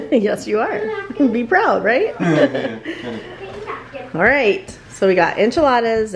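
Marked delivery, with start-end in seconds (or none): none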